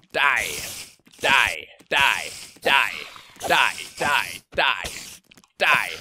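loud, punched attack cries; battle yell. Pretend. Player yelling at a game foe, mock battle cry. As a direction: yell each word as a separate strike.

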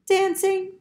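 high put-on duck voice; silly high cartoon duck. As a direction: play the cheeky dancing duck. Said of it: "said the duck".

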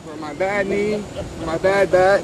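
speaking up. Real, not acted. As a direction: push it out so he hears. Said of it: "Okay, talk up".

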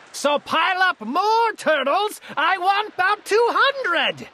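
high-pitched, commanding decree; thundering royal order. A narrator's reading. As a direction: order your subjects about grandly. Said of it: "his royal voice thundered".

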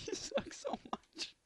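wheezing laugh through the words. Real, not acted. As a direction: squeeze it out mid-laugh. Laughing at friend's fail.

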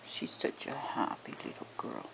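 soft whisper. Real, not acted. Murmuring to dog.

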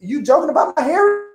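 high, scolding imitation of an offended aunt; a light scolding impression; Kamala Harris voice. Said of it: "Kamala gonna call you".

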